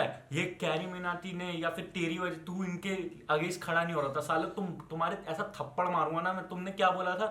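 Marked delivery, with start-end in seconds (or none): none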